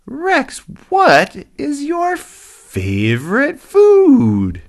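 slow, very high, loud happy hippo; Lucky. Acted. Happy hippo quizzing rhino, squeaky eager voice. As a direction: ask with squealing, drawn-out enthusiasm. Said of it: "Lucky asked questions like".

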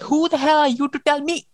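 high-pitched, mock-indignant challenge; indignant retort. Pearl snaps back at Kai, indignant, acted. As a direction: act out an offended comeback.

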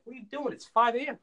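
high-pitched imitation of baffled parents; mock exasperated parents. Parents question son raking leaves at five, mock exasperation.

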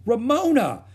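high, loud horrified cry of her name. A narrator's reading. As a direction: shriek her name in horror. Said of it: "cried Beezus, horrified".

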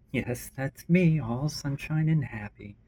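slow, dry sarcastic drawl. Acted. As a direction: lay the sarcasm on thick. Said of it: "Oh, the sarcasm".

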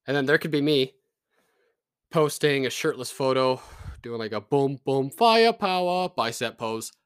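hammed-up tough guy flexing; mock macho pose. Mock macho self posing shirtless.

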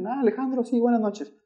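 sweet granny voice, high and hushed; old lady greeting. Retold or imitated. Cab driver voicing the old lady passenger, high and soft.

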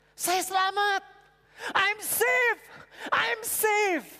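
high, loud, ecstatic shout; high loud joyful outcry; overjoyed cry. Retold or imitated. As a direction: shout it out with relief. Man's joyful cry of salvation.

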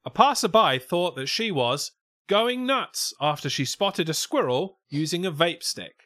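lifted, projected newsreader delivery; news story read. Acted. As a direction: read it out like a silly news report. Reads the news story aloud.

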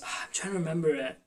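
whispered aside to herself; fast whispered mutter. Real, not acted. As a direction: breathe it out unvoiced, quickly, while trying to remember. Searching memory aside.

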